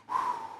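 soft, audible exhale; relieved sigh. Acted. Sinner reminded of God's forgiveness, sighing in relief. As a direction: breathe out, forgiven. Acted release after prayer of forgiveness.

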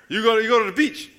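ordinary talk

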